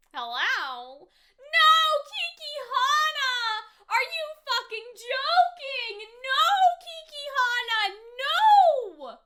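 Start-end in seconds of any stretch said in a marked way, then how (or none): 1.1-9.2 loud, high, drawn-out wailing protest; dismayed outburst. Real, not acted. Viewer pleading at Kiki Hana on screen, dismayed wail. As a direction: wail in disbelief, stretching the words.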